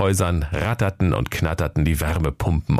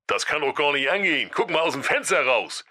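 higher, softer, fast nagging voice; Jakob scolding; 7 semitones higher, 4 dB quieter than usual. Acted. Brother scolding sleepy Santa, fast high nagging. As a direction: rattle off the scolding, quick and high. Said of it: "sein Bruder Jakob rief".

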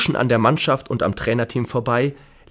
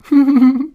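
high bright giggle; 14 semitones higher, 8 dB louder than usual. Acted. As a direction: burst into a short giggle. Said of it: "müssen ein bisschen kichern".

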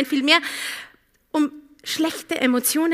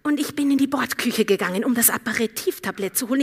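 usual speaking voice

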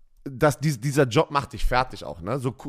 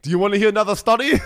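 mock German accent, loud; louder, playful German accent; hammed-up German accent; 6 semitones higher, 5 dB louder than usual. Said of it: "so richtig so mit deutschem Akzent".

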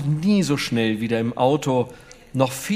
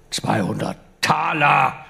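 slow, strict correcting; a stern knight correcting the price; stern correction. A narrator's reading. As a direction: set him straight, firmly and slowly. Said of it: "korrigierte Schröer streng".

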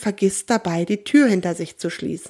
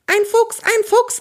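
much higher panicked cry; alarmed cry; 14 semitones higher, 3 dB louder, slower than usual. A narrator's reading. Pigeons wake and warn about the fox, panicked high cry. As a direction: shriek the warning in alarm. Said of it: "ertönt es aus jeder Ecke".

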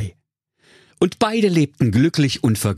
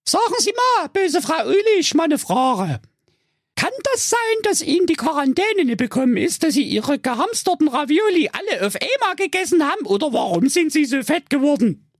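put-on much higher cheeky young girl voice; Rapunzel. Rapunzel mocking the witch, high-pitched girl voice. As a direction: play Rapunzel high and sassy. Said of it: "Rapunzel anfing und zu ihr sagte".

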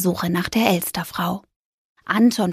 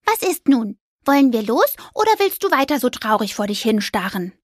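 impatient little mouse; 3 semitones higher than usual. Acted. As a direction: hurry him along, squeaky.

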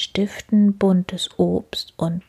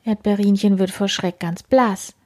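ordinary delivery, nothing marked

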